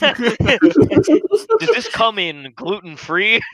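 high, very slow, laughing put-on; mock fussy American. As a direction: whine the order like a picky tourist. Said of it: "he's like".

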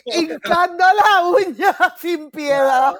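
high, loud and slow, laughing through the words. Real, not acted. Mocking the politician's tweet, high, loud, laughing slowly. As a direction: savor it while cracking up.

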